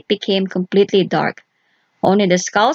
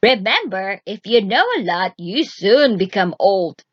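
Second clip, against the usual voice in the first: put-on ominous old witch.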